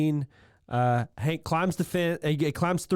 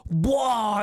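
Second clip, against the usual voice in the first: Hank bois; 6 semitones higher than usual. Retold or imitated. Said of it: "a bois from Hank".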